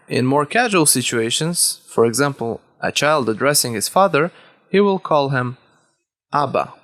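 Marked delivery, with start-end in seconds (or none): none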